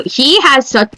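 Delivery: usual speaking voice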